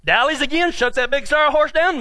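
usual speaking voice

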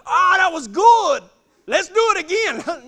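high, loud mock delight; gleeful enthusiasm. Cowboy to scared rider after the near crash, gleeful. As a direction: crow it, thrilled with the run.

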